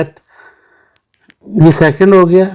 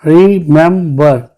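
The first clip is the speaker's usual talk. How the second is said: Remember in three separate beats; teacher's syllable-by-syllable remember; split into syllables; much slower than usual. Shown as a demonstration. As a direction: space out remember as री-मेम-बर. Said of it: "three syllable है".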